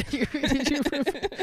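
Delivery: high voice breaking into laughs; laughing. Real, not acted. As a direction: ask it while cracking up. Cracking up at the pun.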